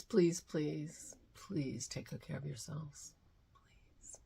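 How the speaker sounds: soft whispered please. Real, not acted. Vlogger begging viewers to stay safe, whispering. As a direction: plead gently in a whisper.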